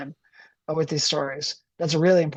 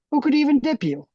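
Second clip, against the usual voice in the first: much higher, mocking disbelief; incredulous; 10 semitones higher, 3 dB louder than usual. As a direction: squeak it out in disbelief. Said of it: "Brunswick Bob was incredulous".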